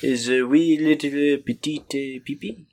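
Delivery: mock French accent.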